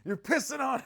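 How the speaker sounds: mock therapist yell. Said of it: "he didn't yell at me, though".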